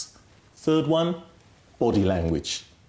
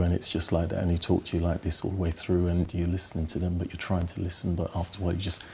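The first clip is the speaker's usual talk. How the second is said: low, quiet, unvarying drone; monotone; 5 semitones lower, 5 dB quieter than usual. Shown as a demonstration. Coach imitates a boring speaker for a client, monotone. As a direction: drone on softly without any inflection. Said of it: "who's speaking very monotone all the way through".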